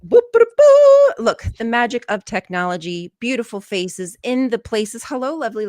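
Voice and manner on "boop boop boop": high, loud singsong boops; cartoonish pop-in sound effect, pitched way up; playful boop noises. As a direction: boop loudly like a magic gadget switching on. Playful noise bringing guests on screen.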